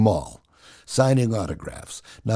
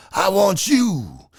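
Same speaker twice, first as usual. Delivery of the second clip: Ali's challenge; 7 semitones higher, much slower than usual. Retold or imitated. Ali challenges Gary to box.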